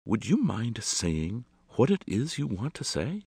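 proceed with ominous over-politeness; a touch of menace under courtesy. A narrator's reading. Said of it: "then proceeded ominously".

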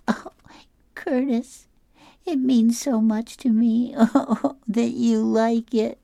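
put-on high, teary girl voice with a laugh breaking through; crying girl. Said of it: "She starts to cry".

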